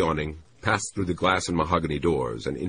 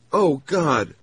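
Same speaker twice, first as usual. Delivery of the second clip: a high, louder gasp of realisation; exclaiming; 11 semitones higher, 5 dB louder than usual. A narrator's reading. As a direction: blurt it out as it hits her. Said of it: "she exclaimed".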